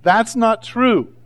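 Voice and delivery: put-on protesting child. Preacher mimicking his children's response to being told, protesting child. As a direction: imitate a kid dragging out a protest. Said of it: "is my children's response".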